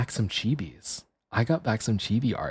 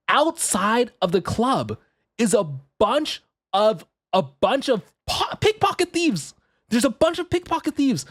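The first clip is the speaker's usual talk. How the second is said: dramatic reveal; 9 semitones higher than usual. Retold or imitated. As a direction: lay out the danger with wide-eyed high pitch. Dramatic reveal of club thieves.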